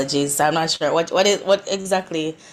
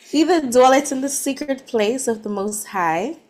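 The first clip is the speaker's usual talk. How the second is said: higher-pitched try at an American accent; attempted American accent; 6 semitones higher than usual. Shown as a demonstration. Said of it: "do I have a good American accent".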